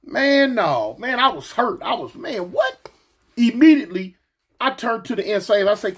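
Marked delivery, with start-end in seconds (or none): 2.5-2.9 very high disbelieving "what?"; stunned squeak of disbelief. Retold or imitated. Retold hurt disbelief.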